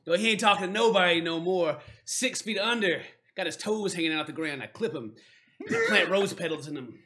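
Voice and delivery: lightly voiced dwarf menace, loud at first; threatening dwarf. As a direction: spin a tall-tale threat as a swaggering dwarf.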